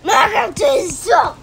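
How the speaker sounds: loud, stubborn comeback; quarrelsome shout. Real, not acted. Sister insisting Minecraft sucks, defiant shout. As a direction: shout it back at them. Siblings quarrel over the game.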